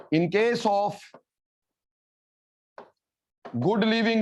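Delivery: slow dictation with long pauses between phrases; classroom dictation. Real, not acted. Teacher giving students notes on leaving groups, slow dictation.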